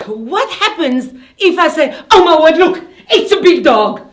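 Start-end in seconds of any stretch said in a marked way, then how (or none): 2.1-4.0 loud, high, laughing "oh my word"; excited exclamation. Shown as a demonstration. Teacher acting out an exclamation for pupils, loud and laughing. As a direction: burst out thrilled at a big dog. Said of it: "either scared or I'm excited".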